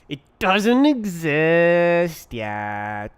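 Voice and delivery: loud, slow, stretched-out wail; drawn-out mock outcry. Coder wailing over a function that doesn't exist, mock outcry.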